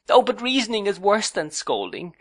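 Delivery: high, despairing protest. A narrator's reading. Young wife protests to her husband in high-pitched despair. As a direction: protest as if all is lost. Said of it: "exclaimed Dora in despair".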